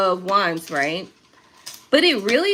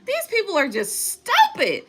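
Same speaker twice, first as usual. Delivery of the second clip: exasperated rant; 7 semitones higher than usual. Real, not acted. Venting about work frustrations.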